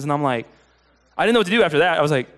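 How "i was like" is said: normal talking voice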